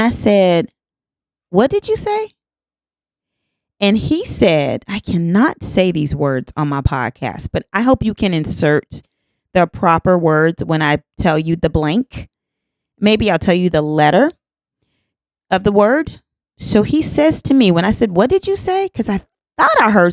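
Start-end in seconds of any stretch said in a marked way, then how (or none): 1.5-2.3 very high, incredulous question; shocked disbelief. Retold or imitated. Disbelief at what he whispered. 18.2-18.9 very high, incredulous question; shocked disbelief. Retold or imitated. Repeats her disbelieving question.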